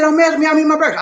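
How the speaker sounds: high-pitched retort in her voice; imitating Lady D. Retelling her reply over breakfast, mimicked high retort. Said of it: "She said".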